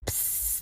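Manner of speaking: soft voiceless psst; catcall hiss. Retold or imitated. Man calling to a woman on the road, hissing. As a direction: imitate a hissed street catcall.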